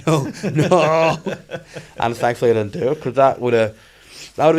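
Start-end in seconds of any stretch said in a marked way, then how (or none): 0.1-1.2 high, loud comic refusal; mock panic. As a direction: resist your own hands in mock horror. Acting resistance to own hands.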